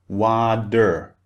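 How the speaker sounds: "water" with a d sound in the middle; "water", the t softened into a d; American flapped T. Shown as a demonstration. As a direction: say "water" slowly as American "wa-der". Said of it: "becomes a D sound, like a D".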